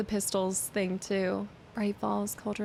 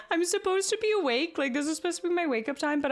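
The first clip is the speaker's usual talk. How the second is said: high, giggling through the words; laughing; 8 semitones higher, 3 dB louder, faster than usual. Real, not acted. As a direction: laugh while confessing. Laughing about waking early.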